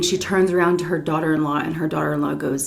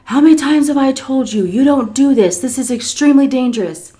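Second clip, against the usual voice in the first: louder, higher, emphatic telling-off; exasperated scolding; 7 semitones higher, 6 dB louder than usual. Retold or imitated. Daughter-in-law scolding Miss C over danger, exasperated. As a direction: scold like you've said it many times.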